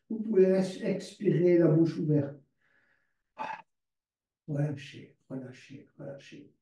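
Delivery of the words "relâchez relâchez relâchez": each relâchez softer until it is only breathed; fading to a whisper. Shown as a demonstration. Yoga teacher easing her class into relaxation, fading to a whisper. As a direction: let the word dissolve into a whisper. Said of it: "teacher guides the relaxation".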